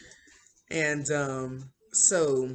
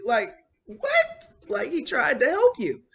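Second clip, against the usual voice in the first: indignant disbelief; 12 semitones higher, 7 dB louder than usual. Real, not acted. Teller's indignant comment.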